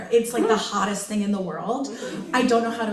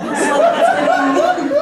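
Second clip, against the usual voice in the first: laughing squeal; 6 dB louder, slower than usual. Real, not acted.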